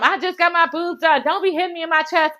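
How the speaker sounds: lightly mimicked high-pitched protest; Florence. Host imitating Florence guarding her new chest, mild mock protest.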